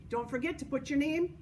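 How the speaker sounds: ordinary delivery, nothing marked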